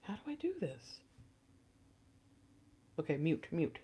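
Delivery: breathy hushed whisper so the audio stays quiet. Real, not acted. Tarot reader fumbling with the volume, whispering. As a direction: whisper it, as if scared of being heard. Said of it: "Don't be loud".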